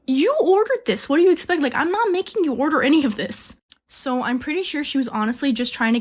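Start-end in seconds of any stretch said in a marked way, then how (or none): 0.1-3.6 high-pitched, incredulous retort; indignant disbelief. Retold or imitated. Cashier reacting to customer's eye-roll, indignant.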